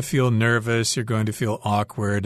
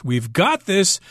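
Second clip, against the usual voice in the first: "got" pitched up high and punched; stress on GOT; 6 semitones higher, slower than usual. Shown as a demonstration. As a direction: hit "got" hard, then fall away. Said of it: "She stressed the word got".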